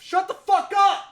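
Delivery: furious high shout upward; shouting up scaffolding. Real, not acted. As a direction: yell it up at the noisy neighbors. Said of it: "Then you just shouted up at them".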